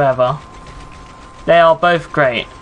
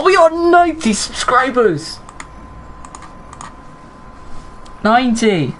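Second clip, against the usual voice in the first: thrilled; 8 semitones higher than usual. Real, not acted. Celebrates subscriber count to viewers.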